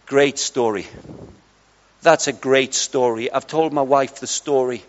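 low, soft, understated praise; imitating a man of few words, low and soft; quiet man. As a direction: voice a reserved man's sincere approval. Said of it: "He's a very quiet man".

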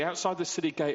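ordinary talk

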